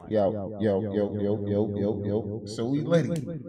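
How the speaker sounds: yo yo testing a toy echo mic. Real, not acted. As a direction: bounce the yos through an echo mic. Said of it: "and then I got my echo mic".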